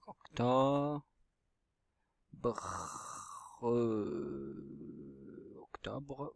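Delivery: stretched syllables, paced to handwriting; dictée syllabique. Shown as a demonstration. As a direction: say Octobre slowly and softly while writing. Dit le mot en l'écrivant. (dictée lente, syllabes étirées)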